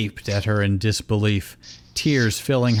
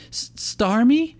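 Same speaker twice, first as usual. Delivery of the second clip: put-on high, slow, halting girl's voice; stammering Misty; 13 semitones higher than usual. A narrator's reading. Misty stammers to her old Pokemon, pleading and high. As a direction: stumble over the name, pleading. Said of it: "Misty stammered".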